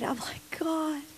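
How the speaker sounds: barely voiced, moved to tears; choked whisper. Real, not acted. Preacher recalling the poor giving everything, moved whisper. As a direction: whisper it, overcome.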